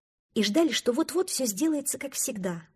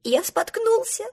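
much higher, slow sobbing; 12 semitones higher, 5 dB louder than usual. Acted. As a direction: sob out the words. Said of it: "всхлипывал утёнок".

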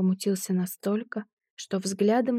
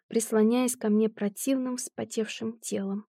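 plain reading voice

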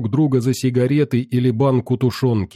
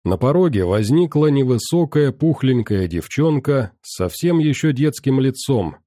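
ordinary delivery

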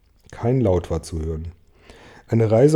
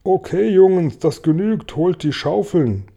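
high, raised foreman's call; 8 semitones higher, 4 dB louder than usual. A narrator's reading. Cloud man orders the others to get shovels, high call. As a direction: call the gang to work, pitched well up. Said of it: "hörte ihn rufen".

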